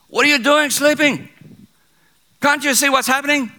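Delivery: very high, loud rebuke; captain's shout. Retold or imitated. Captain rousing sleeping Jonah in the storm, shouting. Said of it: "he shouted".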